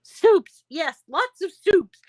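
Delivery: high, loud burst of enthusiasm; excited agreement. Real, not acted. Eagerly agreeing with a viewer.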